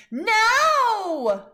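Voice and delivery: put-on high, loud little-kid no; toddler's shout. A narrator's reading. Fudge shouting no at the saddle shoes. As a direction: yell it like a stubborn toddler. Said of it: "he said".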